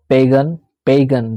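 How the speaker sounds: पेगन said slowly as पे-गन, with a hard ग; careful pronunciation. Shown as a demonstration. Teacher repeating पेगन slowly for students. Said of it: "देखो यहाँ पे क्या पढ़ रहा है".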